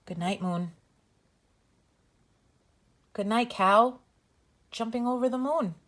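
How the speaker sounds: gentle sing-song bedtime read-aloud; reading to children. Acted. Reads bedtime story to children.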